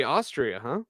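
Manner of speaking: usual speaking voice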